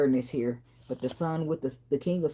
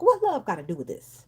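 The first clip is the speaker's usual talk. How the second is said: mock dismissive retort; 5 semitones higher than usual. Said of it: "you try to tell a motherfucker".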